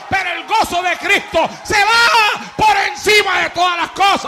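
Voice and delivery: loud, high, preaching at full force; fiery preaching. Acted. Preacher proclaims Christ's joy to the church, loud and fiery. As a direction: thunder it at the congregation.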